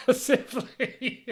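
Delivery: a squeaky, incredulous echo; mocking falsetto. Real, not acted. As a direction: hold the word up for ridicule in a high voice. Mocking the misused word.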